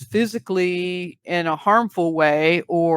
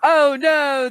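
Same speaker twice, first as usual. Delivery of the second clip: laughing; 8 semitones higher, 4 dB louder than usual. Real, not acted. Reacting with laughter.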